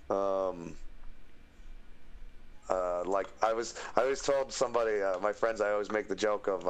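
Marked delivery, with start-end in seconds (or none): none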